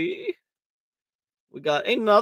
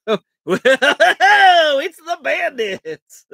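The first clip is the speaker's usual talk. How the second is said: higher, louder cartoon line; cartoon quote; 10 semitones higher, 10 dB louder, much slower than usual. Streamer quoting a kids' cartoon, higher and louder with a laugh. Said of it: "the cartoon that that's from".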